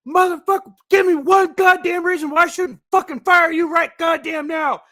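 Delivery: screaming right in his face, pitched high and loud; over-the-top yelling chief; furious shout. Retold or imitated. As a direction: tear into him like an enraged boss. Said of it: "gets up right in his face".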